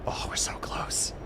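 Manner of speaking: slow tense whisper. Real, not acted. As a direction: breathe it out in suspense. Whispered anticipation near goal.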